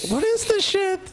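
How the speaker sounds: high disbelieving squeal; incredulous. Real, not acted. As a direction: shriek in disbelief. Incredulous reaction.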